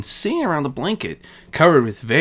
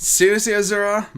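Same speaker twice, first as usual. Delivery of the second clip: rueful; 8 semitones higher than usual. Acted. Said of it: "Merida asked ruefully".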